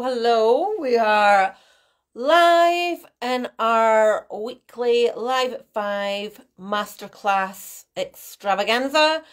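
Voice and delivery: ordinary talk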